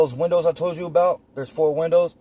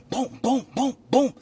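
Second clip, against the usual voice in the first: high, punchy "boom" for each knock; booming knocks, pitched up; imitated banging; 12 semitones higher than usual. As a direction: bang on the window with your voice. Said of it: "So I'm banging".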